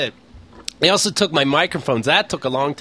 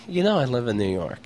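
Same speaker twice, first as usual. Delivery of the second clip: low, quieter deadpan reply; straight-faced; 7 semitones lower, 4 dB quieter than usual. Retold or imitated. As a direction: retell it as a stone-faced answer. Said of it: "do I have to have a straight face".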